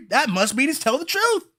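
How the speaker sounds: usual speaking voice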